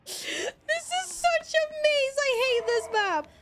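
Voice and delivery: high, slow wailing complaint; whiny lament. Real, not acted. Lost gamer lamenting the map, high whiny wail. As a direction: wail it like you're about to cry. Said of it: "I'm so lost".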